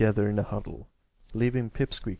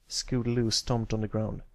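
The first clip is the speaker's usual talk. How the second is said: ordinary delivery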